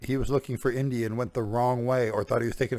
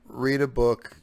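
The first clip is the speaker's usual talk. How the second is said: ordinary talk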